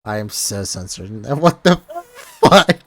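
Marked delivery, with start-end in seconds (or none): none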